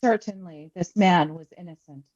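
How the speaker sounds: usual speaking voice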